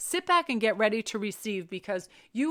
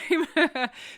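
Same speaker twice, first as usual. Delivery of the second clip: high, loud burst of laughter; real laugh; 7 semitones higher, 6 dB louder than usual. Real, not acted. Streamer amused at her own gladiator-time remark, laughing loudly.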